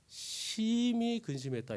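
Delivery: very slow, heavy reading; drawn-out grief. Acted. Preacher reading how the disciples grieved, slow and heavy. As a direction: stretch out the sorrow. Reading scripture, then repeating.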